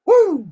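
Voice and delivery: high, loud whoop of joy; excited whoop. Real, not acted. Preacher whooping as he gets fired up in the Lord. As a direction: let out a fired-up church whoop.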